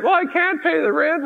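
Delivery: high, pleading heroine voice; squeaky melodrama maiden; damsel in distress. Pretend.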